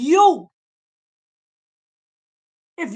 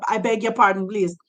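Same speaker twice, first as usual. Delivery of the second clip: normal talking voice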